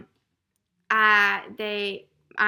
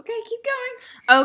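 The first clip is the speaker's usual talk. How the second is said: much higher, slow silly voice; 13 semitones higher, 8 dB louder, slower than usual. Pretend. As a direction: squeak it out slowly. Squeaky voice after whisper.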